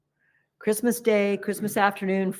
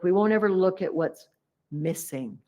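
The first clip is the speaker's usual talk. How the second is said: ordinary talk, nothing marked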